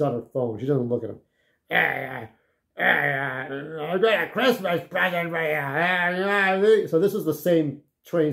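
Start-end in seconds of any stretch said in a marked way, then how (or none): 1.7-6.9 wheelchair man's call. Retold or imitated. Man in wheelchair calls to girl.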